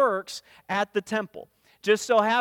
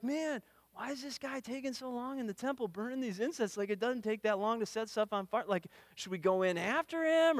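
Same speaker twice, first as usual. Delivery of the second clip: much softer, hushed wondering; murmuring crowd; 9 dB quieter than usual. Retold or imitated. Crowd outside the temple wondering about the delay, hushed and puzzled. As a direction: mutter it among yourselves.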